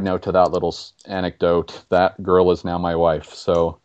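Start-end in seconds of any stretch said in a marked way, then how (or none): none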